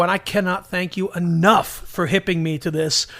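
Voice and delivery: ordinary talk, nothing marked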